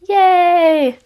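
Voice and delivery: high, loud, drawn-out little hooray; playful cheer. Real, not acted. Vlogger finally solving her sum on camera, playful self-mocking cheer. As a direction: celebrate a tiny win with mock triumph. Mock cheer after fumbling sums.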